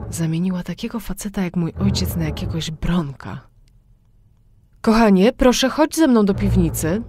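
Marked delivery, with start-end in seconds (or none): 4.8-7.0 high, loud coaxing mother voice; mother's sweet call. A narrator's reading. Mother calls daughter to the cellar, sweetly coaxing. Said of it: "zwróciła się do mnie matka".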